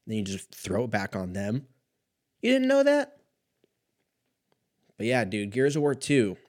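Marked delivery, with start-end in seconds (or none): 2.4-3.2 high, loud squealed question; squeaky hammed-up astonishment; mock incredulity. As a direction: throw it back at them in feigned disbelief. Throwing it back at family.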